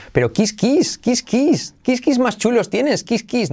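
high, exclaiming parody; mock admiration. Teacher parodying a learners' mistake, high mock admiration.

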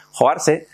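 hoarse as Spanish "jo-AR-se", rising; pronounced as spelled. Shown as a demonstration. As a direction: say Joarse with every written vowel. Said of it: "se escribe joarse". (Joarse leído a la española)

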